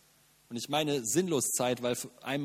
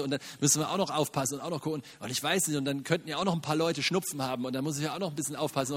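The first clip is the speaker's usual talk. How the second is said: fast fretful rambling; faster than usual. Retold or imitated. Mocking fearful over-caution.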